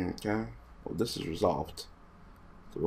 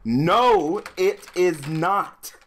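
much higher and louder, dragged-out "it is not"; loud slow denial; 9 semitones higher, 7 dB louder, much slower than usual. Real, not acted. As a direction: shout each word slowly in exasperation. Arguing rules with opponent.

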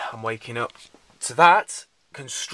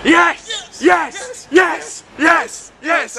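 loud, high, joyful yes shouts; excited shouts; 8 dB louder, much slower than usual. Real, not acted. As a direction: shout it out with glee at your mates. Excited greeting of friends.

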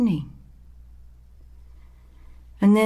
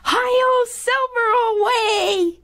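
high, loud, playful shout; full-voiced playground whoop; kid's battle cry; 4 dB louder, much slower than usual. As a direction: yell it like a child riding a pretend horse. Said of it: "screaming at the top of my lungs".

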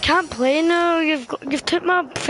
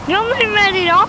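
loud, higher teasing jab at a teammate; teasing callout; 6 semitones higher, 6 dB louder than usual. Real, not acted. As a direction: call him out, half laughing.

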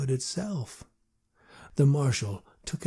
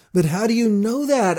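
higher, louder urgent plea; pleading; 8 semitones higher, 6 dB louder than usual. A narrator's reading. Boy pleading with the marshal, higher and louder. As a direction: beg him for an answer. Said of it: "More than asked, I pleaded".

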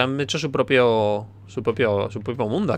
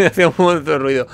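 high, relieved laughing after a scare; nervous laughter; 5 semitones higher, 3 dB louder than usual. Real, not acted. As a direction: laugh through the words, still rattled. (riendo nervioso tras el susto)

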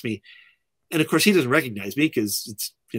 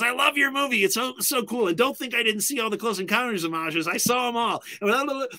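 higher, gushing film-nerd excitement; animated fan; 10 semitones higher than usual. Retold or imitated. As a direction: rave about the movie like a thrilled fan. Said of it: "just animated".

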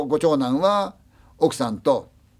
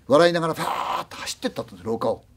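ordinary delivery, nothing marked

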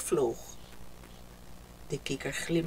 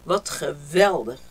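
lower, loud satisfied sigh of a word; contented father; 5 semitones lower, 8 dB louder than usual. A narrator's reading. Father content in his room, low pleased voice. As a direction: savour it like a proud father. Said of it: "zei hij".

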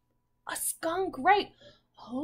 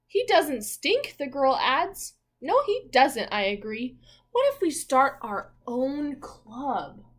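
put-on louder read-aloud, slowing on the girl's lines; animated picture book reading; story reading; 7 dB louder than usual. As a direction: voice the children's chat for the class.

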